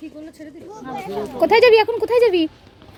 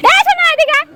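loud, high call to come here; yelling across the room; 8 semitones higher, 5 dB louder than usual. Real, not acted. Calling child from a distance.

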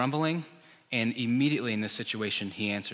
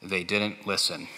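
movie narrator; 5 semitones lower, slower than usual. Retold or imitated. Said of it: "the narrator says".

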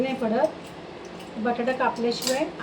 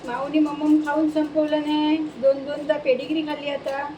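ordinary delivery

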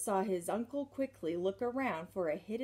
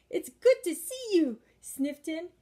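high, loud, gushing greeting; much higher gushing matron voice; gushing society lady; 9 semitones higher, 8 dB louder than usual. Acted. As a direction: beam at him like an overbearing aunt. Miss Lily after bear hug.

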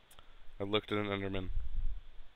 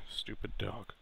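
muttered annoyance; 7 dB quieter than usual. Real, not acted. Player giving up on the dog, soft grumble. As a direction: mutter it under your breath.

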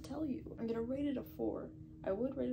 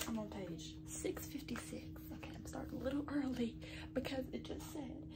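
tense whisper; 5 dB quieter than usual. Real, not acted. Whispering tense reading update.

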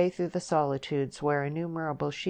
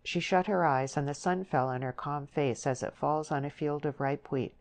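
usual speaking voice

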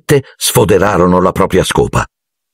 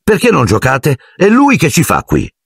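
put-on higher boyish voice, puzzled; boy's question; 9 semitones higher than usual. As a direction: ask as a young boy, surprised. Harry's lines voiced. (voce da ragazzo stupito)

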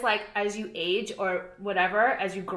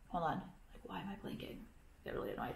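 whispered, much softer aside; muttered aside; 6 semitones lower, 18 dB quieter than usual. Real, not acted. Annoyed at her own blinking, whispered aside.

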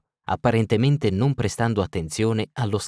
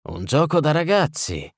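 higher, scornful huff; scoffing snort; 7 semitones higher than usual. Acted. The burgomaster scoffs at the easy cure, huffing. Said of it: "sbuffò Velerad".

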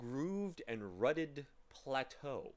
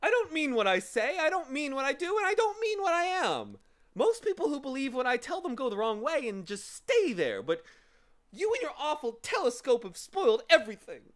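high, loud, wailing outburst; hammed-up, high-pitched blubbering; sobbing; 12 semitones higher, 9 dB louder than usual. A narrator's reading. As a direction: wail it like a tearful little creature. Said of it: "he sobbed".

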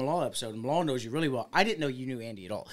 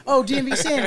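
high, loud mock realization; pitched-up, loud re-enacted greeting; excited recognition; 12 semitones higher, 9 dB louder than usual. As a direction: act out suddenly placing someone. Acting out their excited recognition.

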